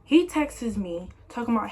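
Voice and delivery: ordinary talk, nothing marked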